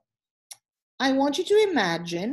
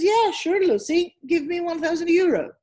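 higher, louder cocky boatman demanding money; greedy boatman; 7 semitones higher, 5 dB louder than usual. Acted. Teacher voicing greedy Billy the boatman, higher and louder. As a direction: play Billy the boatman asking a fortune.

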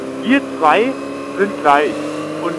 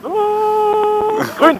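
high, sudden cry of delight; excited; 14 semitones higher than usual. Real, not acted. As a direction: call out that the light is green. Traffic light turns green.

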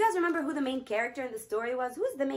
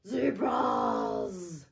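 low roaring lion voice; 6 semitones lower than usual. Acted. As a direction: roar it out like a lion. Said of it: "roared the lion".

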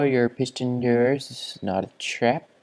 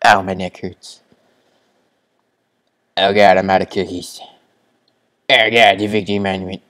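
loud; 12 dB louder, faster than usual. Real, not acted. Loud complaining.

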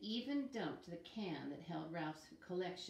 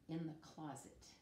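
ordinary delivery, nothing marked

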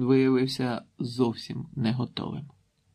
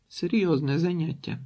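ordinary delivery, nothing marked

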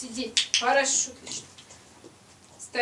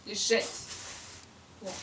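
ordinary talk

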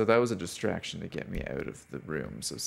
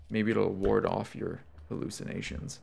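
ordinary delivery

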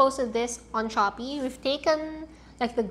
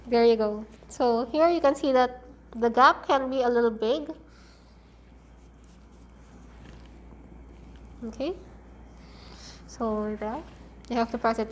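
muffled behind cloth mask; speaking under fabric covering; through a face mask. Real, not acted. Seen 2: Blue mask worn to 10.6, removed briefly, pink mask from 14.3.

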